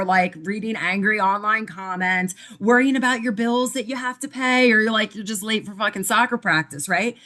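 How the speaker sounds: ordinary talk, nothing marked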